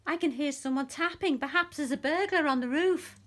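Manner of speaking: high and loud worried mouse; small anxious mouse voice; Little Mouse, worried. A narrator's reading. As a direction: fret aloud like a tiny mouse. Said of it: "called Little Mouse".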